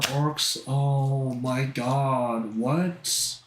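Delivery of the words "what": normal talking voice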